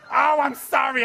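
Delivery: high, loud sorry; mock apology. Incense guy apologises for burning Rinpoche, high and loud.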